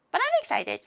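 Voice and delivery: sudden high, bright burst of excitement; excited. Real, not acted. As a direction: squeal it with glee. Repeats she is excited.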